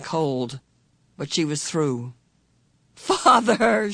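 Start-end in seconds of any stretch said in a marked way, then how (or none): 2.9-3.9 higher, louder call of joy; cried out. A narrator's reading. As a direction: call out to her father as you reach him. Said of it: "she cried".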